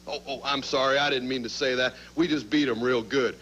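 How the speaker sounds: low, sarcastic fake sorry; mock apology. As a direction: pretend to take it back, tongue in cheek. Fake apology after insult.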